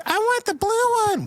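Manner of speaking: put-on very high, whiny little-kid voice. Little kid demanding a pinwheel at the cart, very high-pitched. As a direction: squeal it like a small child. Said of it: "little kid goes like".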